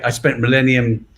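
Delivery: ordinary delivery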